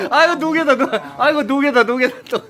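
high, laughing delight; laughing cheer. Real, not acted. Dad cheers kids' game. (웃으며 환호)

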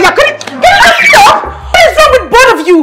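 loud, high-pitched outrage; furious confrontation. Acted. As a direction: shout the accusations indignantly. Woman confronts cheating man.

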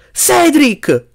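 high-pitched warning cry; yell. A narrator's reading. Harry warns Cedric of the looming danger, yelling. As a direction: yell the name in alarm. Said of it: "urlò Harry".

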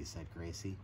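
ordinary delivery, nothing marked

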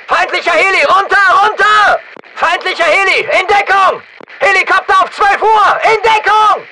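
high-pitched shouted warning; urgent warning. Acted. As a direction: call your squad into cover. Warning squad of enemy helicopter.

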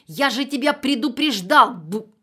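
shouted high and loud, cut off; loud angry warning. A narrator's reading. Angry neighbour shouting on the answering machine. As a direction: yell the warning, then break off.

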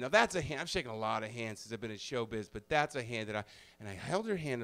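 ordinary talk, nothing marked

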